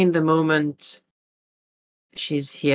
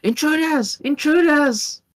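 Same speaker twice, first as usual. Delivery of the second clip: high, harsh bandit alarm shout; feral wasteland raider; 11 semitones higher, 4 dB louder than usual. Acted. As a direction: yell it like a raider spotting intruders. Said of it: "And the bandit shouts".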